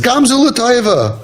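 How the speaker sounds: high, slow mock-consoling delivery; drawn-out, high-pitched parody of pious comfort; mocking platitude. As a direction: stretch it out high like a glib catchphrase. Mocks glib catchphrases to sufferers.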